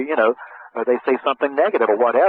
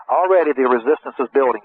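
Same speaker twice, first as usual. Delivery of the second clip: ordinary delivery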